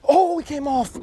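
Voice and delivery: sharp high cry of dismay. Real, not acted. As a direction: blurt it out, high and shocked. Fish lost, sudden dismay.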